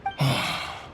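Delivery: soft breathy sigh of defeat; resigned sigh. Acted. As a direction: let out a weary sigh. Adeptus sighs in resignation. (諦めのため息)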